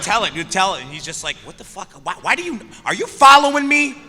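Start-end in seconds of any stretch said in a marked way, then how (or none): 0.0-0.8 urging, laughing. Retold or imitated. 1.4-4.0 freaked-out actor rising to a squeal; alarmed. Retold or imitated.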